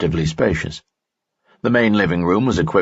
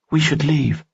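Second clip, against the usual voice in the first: higher young woman's voice, lightly voiced; worried young woman, pitched up; Dina's voice; 9 semitones higher than usual. Dina speaks in story.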